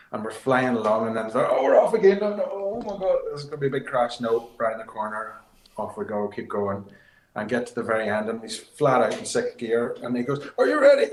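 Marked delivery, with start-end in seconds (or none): 1.5-4.2 high, loud panicked yelp; retold panic. Young co-driver in the dark stage, high and loud with mock panic. 10.6-11.1 put-on much higher, loud Finnish-accented call; Finnish driver's question. Rally driver asking the co-driver before the timing, high and loud in a Finnish accent.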